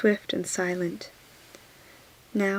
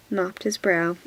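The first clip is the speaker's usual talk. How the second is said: usual speaking voice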